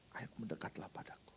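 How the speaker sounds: whispered invitation, barely voiced; soft whisper. Elijah inviting the people near, whispered. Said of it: "Dia ubah pola komunikasinya".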